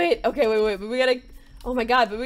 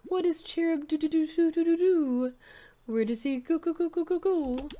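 forlorn Cherub. Acted. Voices game figure Cherub.

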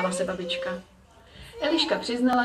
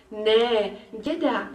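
exclaiming; much slower than usual. A narrator's reading. Girl correcting mum about the visitor, mild exclaiming. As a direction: call it out lightly, eager to correct. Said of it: "vykřikla Eliška".

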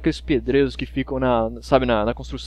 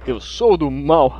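slow, louder cartoon villain boast; mustache twirling villain; 4 dB louder, much slower than usual. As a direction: gloat like a hammy villain. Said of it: "Olha só que risada maligna".

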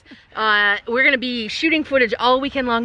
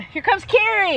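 high-pitched excited call; 11 semitones higher than usual. Real, not acted. Host announces Carrie's arrival to viewers, calling out high. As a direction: announce her with a rising shout.

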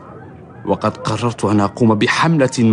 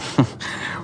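soft breathy laugh; 7 dB quieter than usual. Acted. Laughs at good news.

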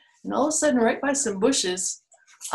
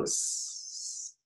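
snake hiss; 14 dB quieter than usual. Retold or imitated. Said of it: "[name], can you go".

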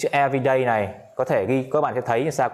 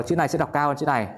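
usual speaking voice

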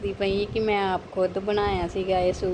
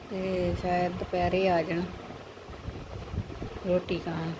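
usual speaking voice

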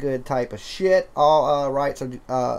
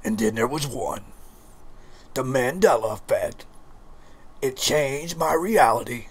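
scary voice; 2 semitones lower than usual. Pretend. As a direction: creep the words out ominously. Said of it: "in a scary voice".

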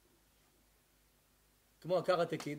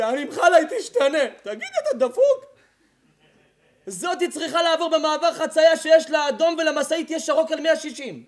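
higher, louder mocking rant; fiery preaching with laughter; 10 semitones higher, 15 dB louder than usual. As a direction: tear into the lovesick young man. Preaching at full force.

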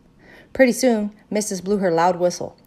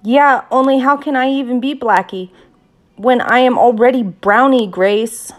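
put-on loud, higher indignant child; indignant objection; 6 semitones higher, 10 dB louder than usual. A narrator's reading. Girl objects to Grace's trade idea, indignant. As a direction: protest it loudly, pitched up. Child argues with friend over roles.

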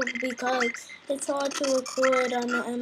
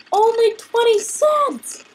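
slow, high, loud sarcastic cry; mock delight at the price; 9 semitones higher, 6 dB louder, slower than usual. Real, not acted. Mocking the price.

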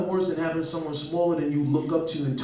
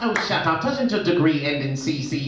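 wife's British put-down. Retold or imitated. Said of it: "that's how she wins the argument".

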